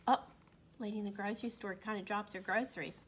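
plain reading voice

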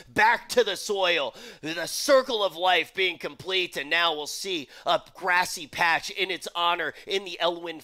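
animated, projected esports commentary; live play-by-play. Acted. Commentator calls the pet battle to viewers, live play-by-play. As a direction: call the pet battle like a big sporting match.

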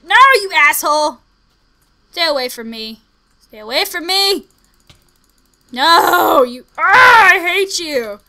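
high loud furious shouting; real outrage; angry yelling. Real, not acted. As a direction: yell at the attacker. Yelling at attacking zombie.

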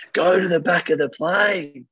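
half-hearted imitation of a woman telling him off; mock angry scolding. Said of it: "giving him a mouthful".

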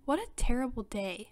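ordinary delivery

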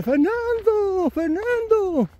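high, slow, drawn-out call of a name; calling from afar. Retold or imitated. José calling out to Fernando from far off, high and drawn out. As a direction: call out across the distance, stretching the name. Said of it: "oigo la voz de José y dice".

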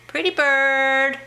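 singsong bird talk; talking to a parrot. Real, not acted. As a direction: coo it slowly to a bird.

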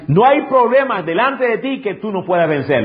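high, ringing preacher's proclamation; preaching emphasis. Real, not acted. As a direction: proclaim it high to the whole church. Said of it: "Lo repito porque parece que no me escuchaste".

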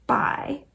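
Bai said like the word buy; name pronounced. Shown as a demonstration. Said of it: "It's pronounced kind of like".

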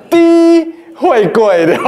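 低会贵 with a long, high first syllable; laughing, drawn-out reading of 低会贵; demonstrated reading. Shown as a demonstration. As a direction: stretch out 低会贵 slowly and high, amused. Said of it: "这念".

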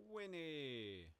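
high, loud call across distance. Real, not acted. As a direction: call the horse over. Calling horse by name.

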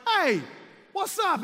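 high, loud cheerful hey; excited greeting. Retold or imitated. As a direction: greet him big and bright. Acted greeting meeting old teammate.

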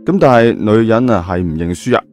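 ordinary delivery, nothing marked

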